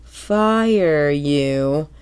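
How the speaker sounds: drawn out slowly in a put-on voice; slow dawning realization. SpongeBob slowly realizing he can fire Pearl, drawn out. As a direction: let the idea dawn word by word. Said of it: "he, like, realizes".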